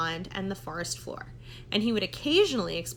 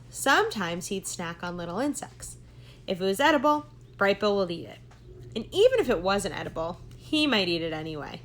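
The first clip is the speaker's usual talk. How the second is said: usual speaking voice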